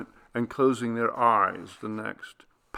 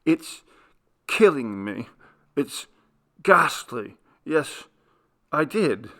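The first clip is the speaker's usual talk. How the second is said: voice breaking; 4 semitones higher, 4 dB louder than usual. A narrator's reading. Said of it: "his voice broke in spite of his effort".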